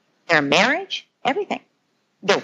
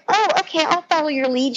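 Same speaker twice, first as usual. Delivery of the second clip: put-on cheerful compliant child; 8 semitones higher than usual. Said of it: "they're like".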